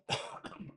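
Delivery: a short dry real cough. Real, not acted. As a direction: clear the throat with one cough. Real cough mid-story.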